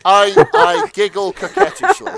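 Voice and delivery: loud, high, laughing read; erotica voice breaking up. Acted. Reader cracks up narrating Amelia's giggle, loud laughing delivery. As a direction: lose it laughing mid-narration. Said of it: "my sort of brief erotica voice".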